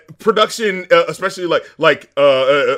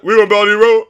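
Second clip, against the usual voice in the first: mock [name] mumble; 5 semitones higher, 7 dB louder, much faster than usual. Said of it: "he's like".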